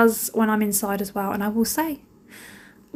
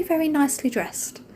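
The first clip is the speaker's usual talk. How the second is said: ordinary talk, nothing marked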